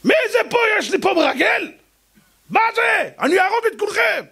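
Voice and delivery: high furious shouting king. Acted. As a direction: roar like a paranoid king hunting a spy.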